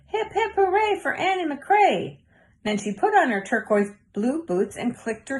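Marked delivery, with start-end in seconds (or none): 0.1-2.2 much higher, chirpy puppet cheer; cheering herself on. A narrator's reading. Girl cheering for herself through her toy horse, high. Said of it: "cheered for herself".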